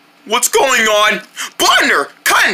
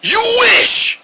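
high shouted retort; 10 semitones higher than usual. Acted. Armadillo retorts.

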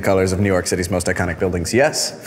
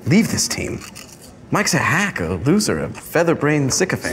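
haughty taunt; 7 semitones higher than usual. Acted. Rival taunting.

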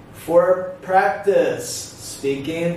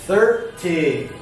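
first syllable of thirty raised, second falling; stress on THIRty. Shown as a demonstration. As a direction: hit THIR, then let ty drop. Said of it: "Listen for the stress".

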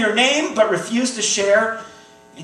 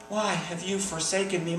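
"why have you forsaken me?" dropped to a near-whisper, much softer; hushed forsaken cry; 3 semitones lower, 11 dB quieter than usual. Acted. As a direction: let the question fall away softly.

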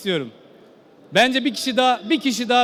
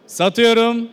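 auctioneer; 2 semitones higher, 4 dB louder, much slower than usual. Real, not acted. Auctioneer closing the sale.

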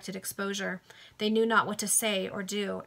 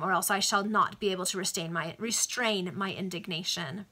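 plain reading voice

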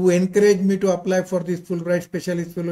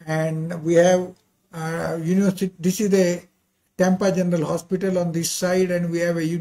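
usual speaking voice

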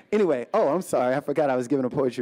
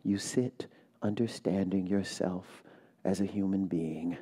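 slow, soft, measured poem reading; poem recitation; 3 semitones lower, 5 dB quieter, slower than usual. Acted. Poet reading her poem to a hushed audience, slow and soft. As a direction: let each line settle, quiet and deliberate.

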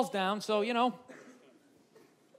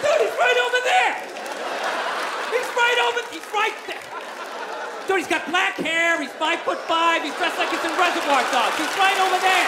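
pointing out the thief; 6 semitones higher than usual. Acted. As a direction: yell it across the crowd, pointing. Acted pickpocket pointing at someone.

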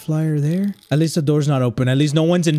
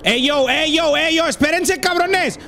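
calling out; 9 semitones higher than usual. Real, not acted. Calling out to unseen people.

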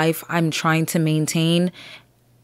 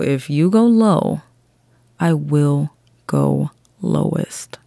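slow, low, drawn-out warning; mock menace, slowed right down; slow menacing threat; 4 semitones lower, slower than usual. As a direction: lay each word down slowly. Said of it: "slow menacing threat".

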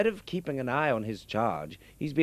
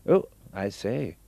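ordinary talk

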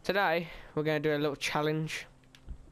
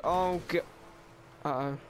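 ordinary delivery

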